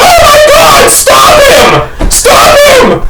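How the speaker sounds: high, frantic shouted plea; panicked screaming. Retold or imitated. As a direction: scream for someone to stop it. Acts out screaming to stop dog.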